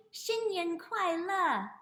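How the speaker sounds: slow, high, loud model phrase; teaching demo. Shown as a demonstration. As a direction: sound it out slowly and cheerfully for repeating. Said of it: "Can you say that?".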